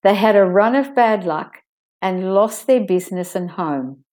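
"run of bad luck" linked, "of" reduced; linked stressed demo. Shown as a demonstration. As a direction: link "had a run of" smoothly. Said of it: "I'll start that sentence again".

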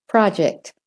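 "project" in American, with an open "prah"; American vowel in "project"; American accent. Shown as a demonstration. Teacher models for accent learners, American "project". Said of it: "practicing the American accent".

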